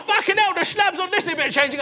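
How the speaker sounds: loud, high cockney bloke; blokey cockney bluster; cockney geezer. Retold or imitated. Impressing the builder in a loud cockney accent. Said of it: "talking to him like I was Danny Dyer".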